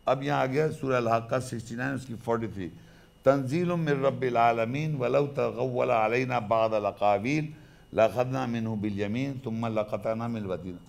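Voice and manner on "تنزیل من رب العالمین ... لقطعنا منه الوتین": slow, measured chanted recitation. Acted. Lecturer pauses to recite the verses slowly.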